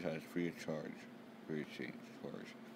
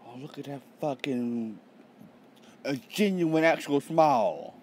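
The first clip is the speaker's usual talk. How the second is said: higher, louder, drawn-out amazement; delighted reaction; 7 semitones higher, 8 dB louder than usual. Real, not acted. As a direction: gush over a rare sight. Delighted reaction to a character's smile.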